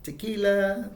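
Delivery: a high, excited cry of the word; imitating a gang's cheer. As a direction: call it out like the song's end. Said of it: "at the end they're like".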